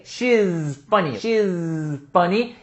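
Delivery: very slow "she's funny" with a clear z; slow repeated "she's funny"; slow demo. Shown as a demonstration. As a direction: stretch the z of "she's".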